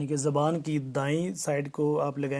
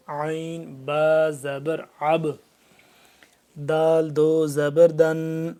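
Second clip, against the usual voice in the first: slow spelling drill; 4 dB louder, slower than usual. Shown as a demonstration. Teacher chants the spelling drill.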